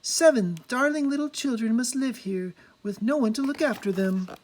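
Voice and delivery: put-on high, sweet girlish voice. A narrator's reading. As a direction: voice her as a kind young princess.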